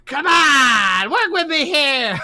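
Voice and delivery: high, shouting clown voice; silly clown. Acted. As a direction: clown begging for a laugh. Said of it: "It's like".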